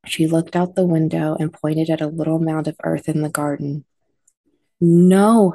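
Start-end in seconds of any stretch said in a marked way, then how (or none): 4.8-5.5 a loud, high cry of no; a mother's loud, high cry of horror; anguished cry. Acted. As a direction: cry it out in disbelief. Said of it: "her mother cried".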